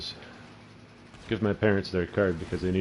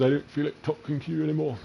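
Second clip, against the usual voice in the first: high, huffy mock British voice; fake British accent; 8 semitones higher than usual. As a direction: imitate a phony Brit, pitched way up. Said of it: "speak with a fake British accent".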